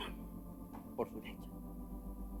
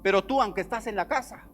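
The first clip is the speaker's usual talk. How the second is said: preaching at full force; 8 semitones higher, 10 dB louder, slower than usual. Acted. Preaching the father's rebuke at full force.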